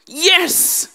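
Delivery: high, bursting yes; eager yes. Acted. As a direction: blurt it out eagerly. Peter eager to answer.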